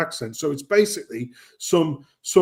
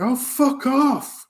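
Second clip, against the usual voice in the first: exasperated; 10 semitones higher, much slower than usual. Retold or imitated. Exasperated reaction to ad.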